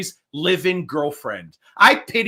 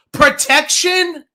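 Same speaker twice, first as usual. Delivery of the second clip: high, drawn-out indignant echo; incredulous outrage; 6 semitones higher, slower than usual. Real, not acted. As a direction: throw the word back in disbelief. Outraged echo of the rival's word.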